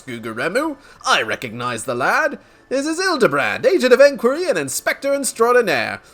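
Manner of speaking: put-on jovial old-fashioned character with swinging pitch; big, genial game voice; hearty peacemaker. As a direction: laugh it off and calm the room heartily.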